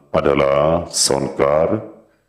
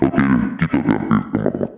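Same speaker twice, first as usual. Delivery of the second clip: pitched-down giant voice filter; deep processed voice, fast; 5 semitones lower, faster than usual. Acted. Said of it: "suara kayak suara raksasa".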